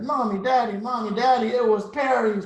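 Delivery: high, loud wail to parents; hammed-up tattling child; crying out. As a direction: cry out like an upset little girl. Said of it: "cried Olivia".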